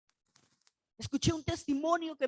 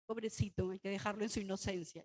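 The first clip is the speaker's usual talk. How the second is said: pitying; 7 semitones lower, 10 dB quieter than usual. Retold or imitated.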